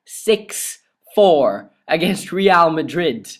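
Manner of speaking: "six four against Real Madrid" dragged out slowly; slow emphatic repeat. Real, not acted. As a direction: spell the score out word by word. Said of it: "I repeat".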